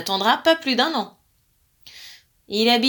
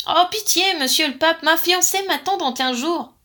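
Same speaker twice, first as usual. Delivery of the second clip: higher, louder pleading giant; begging; 6 semitones higher, 5 dB louder than usual. A narrator's reading. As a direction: implore the pope. Giant begs the pope.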